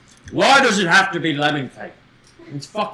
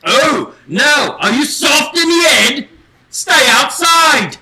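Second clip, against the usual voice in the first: loud and high, half laughing; laughing fake outrage at the door; mock outraged shout; 9 semitones higher, 7 dB louder, slower than usual. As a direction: shout him away from the door. Host refuses entry to pig's feet.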